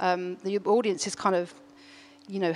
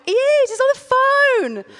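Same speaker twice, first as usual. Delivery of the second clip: much higher, loud, slowed insistence; insistent shout; 9 dB louder than usual. Retold or imitated. Brother shouting up the stairs that he really is on the phone. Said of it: "And he went".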